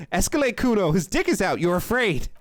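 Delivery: performed yell. Acted. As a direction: call it out loud and pushed up in pitch. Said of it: "'cause I'm yelling a lot".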